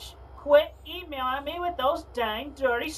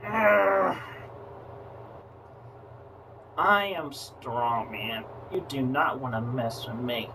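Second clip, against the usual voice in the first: barbarian; 6 semitones lower than usual. Acted. Tough challenge in low voice.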